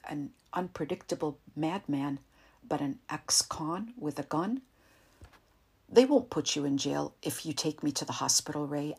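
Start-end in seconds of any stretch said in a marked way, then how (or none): none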